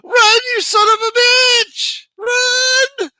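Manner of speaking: put-on high, loud old-lady shriek; grandmother screaming. Grandmother screaming at the boy chased by a dog. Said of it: "my grandmother was looking out that window".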